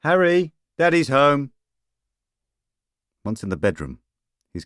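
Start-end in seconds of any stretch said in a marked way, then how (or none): 0.0-1.5 loud, high call to his son. A narrator's reading. Father calling to his son in the empty house, loud and high. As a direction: call out to the house, hoping for an answer. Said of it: "He called out again".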